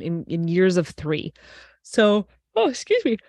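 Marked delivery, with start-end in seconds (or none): 1.8-3.2 mid-yawn apology, words pulled high; talking through a yawn. Real, not acted. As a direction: excuse me stretched out through a real yawn. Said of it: "No wonder you're yawning".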